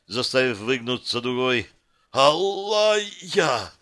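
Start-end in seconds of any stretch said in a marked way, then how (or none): 2.1-3.7 high strained rasp of pain; hoarse cry. A narrator's reading. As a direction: force her name out through pain. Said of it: "прохрипел я".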